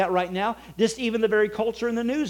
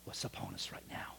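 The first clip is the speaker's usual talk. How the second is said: unvoiced whisper, much softer; 20 dB quieter than usual. Preacher telling the congregation the glory is near, whispered. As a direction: drop it to a secret whisper. Hushed line in sermon.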